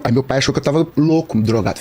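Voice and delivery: usual speaking voice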